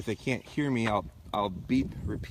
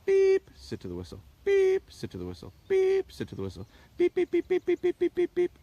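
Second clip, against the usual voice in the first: high sharp beeps like a dog whistle; piping whistle beeps, short and crisp; whistle imitation; 3 dB louder than usual. Shown as a demonstration. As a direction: squeak out each blast like a training whistle. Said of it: "I want single blast".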